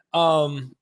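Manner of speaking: ordinary talk, nothing marked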